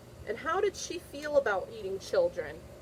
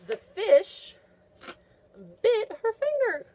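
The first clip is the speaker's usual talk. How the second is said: high, loud and thrilled; excited reveal; 12 semitones higher, 7 dB louder, slower than usual. Acted. Teacher revealing the bite to the class, high and thrilled. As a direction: build the big surprise for the kids.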